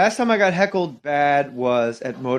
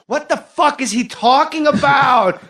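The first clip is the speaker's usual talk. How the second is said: much higher, loud, slow incredulous heckler; mock a baffled woman in the crowd; heckler's outburst; 11 semitones higher, 4 dB louder, slower than usual. Comic imitating a confused audience member, high and loud. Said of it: "she goes".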